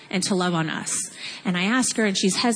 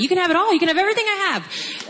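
overflowing generosity; 9 semitones higher, faster than usual. Retold or imitated. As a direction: give her everything in an overjoyed rush. Giving her everything, overwhelmed.

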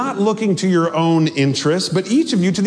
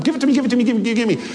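higher, insistent, repetitive mock demand; worked-up pleading; 6 semitones higher, much faster than usual. Preacher mimicking the ghost before she flamed out, worked-up demanding. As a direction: imitate someone frantically demanding, words tumbling over each other. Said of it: "She's just, like, so worked up".

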